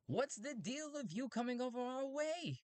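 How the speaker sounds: very high, strained robot voice; strange robot. Acted. Robot demanding why Talti approaches, squeaky high voice. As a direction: push it up into a silly robot squeal.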